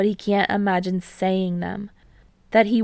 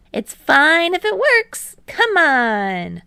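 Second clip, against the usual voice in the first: put-on higher, louder boyish teammate; Hinata, eager; 8 semitones higher, 7 dB louder, slower than usual. A narrator's reading. Hinata bragging to Kei about deflections, higher, louder and eager. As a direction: bounce up, brag a bit.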